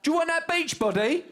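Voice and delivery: high, loud street-hawker yell; hammed-up loud street pitch; mock preacher shout. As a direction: shout it like a pushy street preacher selling fitness. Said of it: "you got to be shouting at me".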